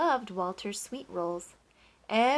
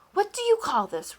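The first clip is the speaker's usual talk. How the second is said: high, loud angry roar; 11 semitones higher, 8 dB louder than usual. A narrator's reading. Duke confronting the baker, roaring in anger. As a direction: bellow the question in fury. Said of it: "roared the Duke".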